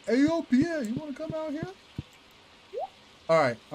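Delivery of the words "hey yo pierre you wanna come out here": high, far-off call. Pretend. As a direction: call out to the shop. Calling out to a shopkeeper.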